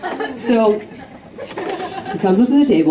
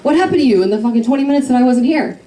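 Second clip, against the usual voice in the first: higher, fast exasperated friend; friend Sydney scolding; 6 semitones higher, faster than usual. Retold or imitated. Friend Sydney arriving at the table, higher and fast. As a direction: rattle it off in disbelief. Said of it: "And she's like".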